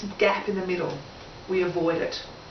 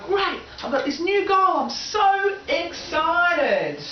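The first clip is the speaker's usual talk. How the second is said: excited; 6 semitones higher, slower than usual. Pretend. Goal setter voices own excitement.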